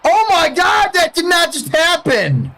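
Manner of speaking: shocked laughter. Real, not acted. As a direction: yell in stunned disbelief. Opponent scores unexpected runs.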